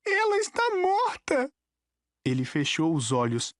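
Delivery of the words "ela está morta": put-on high, anguished boy's outburst; grief-stricken fury, pitched very high; furious. A narrator's reading. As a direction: hurl it out in a high, strained voice. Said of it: "tremia de fúria".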